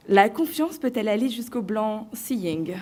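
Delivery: reads "blanc seing" as English "blanc seeing", slow and careful; a French student trying her best English accent on "seeing"; mock English accent. Student proudly reading her subject aloud in a mock English accent. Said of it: "de mon plus bel accent".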